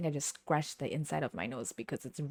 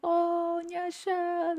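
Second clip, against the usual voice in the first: high, sing-song delighted greeting; teacher's high greeting; 12 semitones higher, much slower than usual. Retold or imitated. Teacher spotting her old student, high delighted coo. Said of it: "she was just like".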